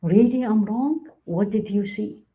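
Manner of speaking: slow, high rising surprise, then a curious question; drawn-out, wondering question, open to feedback; open, receptive questioning. Shown as a demonstration. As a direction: invite the correction with genuine interest. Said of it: "Huge difference".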